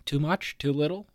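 ordinary delivery

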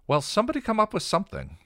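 panic. Acted. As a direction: blurt it out in a panic. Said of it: "Anya began to panic".

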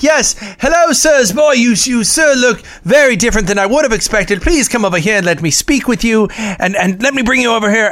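put-on high, lilting Olde English courtly accent; Renaissance accent. Actor greets Klingon fans in an Olde English accent. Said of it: "then he would completely drop the accent".

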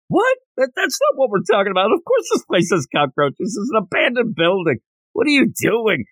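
high-pitched, rushed disbelief; incredulous outburst. Retold or imitated. Readers react to the cockroach verdict, incredulous. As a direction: jump up in pitch, baffled and protesting.

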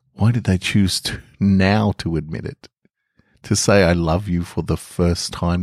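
calm, measured storytelling read; story narration. A narrator's reading. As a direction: tell it gently, like an intimate bedtime tale. Reads a short story aloud.

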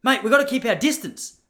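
high, loud panicky stop, stop; alarmed postman. Retold or imitated. Postman telling the narrator to keep away, in a high panicky yelp. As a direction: mimic a jumpy postman waving him off. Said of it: "He goes".

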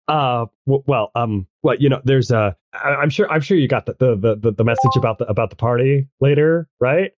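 halting, stammered lead-up; nervous. Acted. Shy goth girl working up to asking Earl out, nervous. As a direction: stumble nervously over every phrase. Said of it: "kinda, kinda nervous".

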